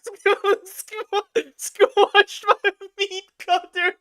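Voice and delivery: high, slow, breaking up with laughter; laughing. Real, not acted. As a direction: choke on the absurdity. Rereads absurd insult, laughing.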